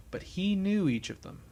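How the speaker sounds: usual speaking voice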